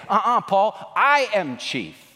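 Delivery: Rahab protesting. Retold or imitated. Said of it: "And Rahab would say".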